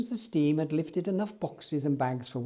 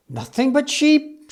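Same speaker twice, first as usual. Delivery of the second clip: higher, louder cross yell; angry shout; 9 semitones higher, 7 dB louder than usual. Acted. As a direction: shout in disgust. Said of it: "shouted Eli angrily".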